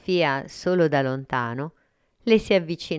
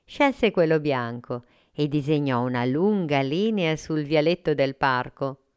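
plain reading voice

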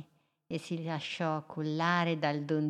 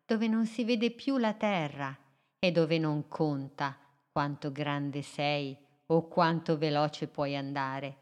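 ordinary delivery

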